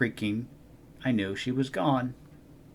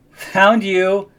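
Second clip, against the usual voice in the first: triumphant; 11 semitones higher, 12 dB louder than usual. Acted. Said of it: "I laughed".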